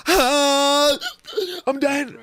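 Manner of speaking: high strained mock-dying cry; harsh dying cutoff. Shown as a demonstration. Singer mocks a harsh bad note ending, hammed-up.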